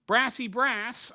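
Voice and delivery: "Brassy brass" drawn out slowly and high, with a flat American æ; hammed-up American drawl on "brass"; mock American accent. A mock American accent, teasing a viewer. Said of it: "that's not an impression of you".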